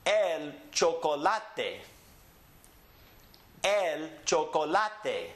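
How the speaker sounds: slow El chocolate, el cho-ko-LA-te; Spanish pronunciation. Shown as a demonstration. Teacher models for repeating, El chocolate slowly stressed on LA. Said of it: "Repeat after me".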